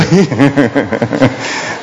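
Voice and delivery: a short, warm chuckle; amused laugh. Real, not acted. Priest amused by the son who curses only his elders, short laugh. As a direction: laugh lightly at the joke.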